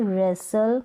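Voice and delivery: रेसल said with no T; silent T. Shown as a demonstration. Teacher giving the correct रेसल with silent T. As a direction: say रेसल dropping the T. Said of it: "अब इसको रेस्टल नहीं".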